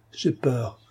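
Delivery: slow, faltering and pained; a mild faint fearful plea, slowing down; frightened. A narrator's reading. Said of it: "bégaya Coralie". (peur légère, lente)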